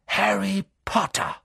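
high, slow, drawn-out name; aghast teacher's cry; outraged teacher. A narrator's reading. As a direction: call the pupil out in horror. Professor catches the pupil.

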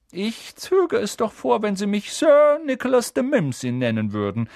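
high, loud prim correction; slightly miffed. A narrator's reading. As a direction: correct them, a little offended. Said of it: "erwiderte der Geist leicht pikiert". (leicht pikiert)

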